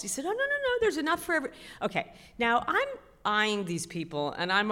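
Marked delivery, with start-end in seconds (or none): 0.2-1.5 much higher, breezy mock-cheerful guide; guide's reply. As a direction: chirp it like an overly upbeat guide.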